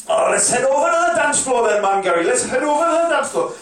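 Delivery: loud Geordie lad calling his mate; drunk punter. Retold or imitated. Bloke on the ferry calls his mate Gary over. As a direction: shout across to Gary, half-cut.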